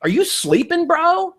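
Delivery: high, playful ribbing; teasing jab. Retold or imitated. Ribbing a lukewarm friend, playful high tease. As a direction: poke fun at a sleepy friend. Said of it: "you're my friend, so I can say that to you".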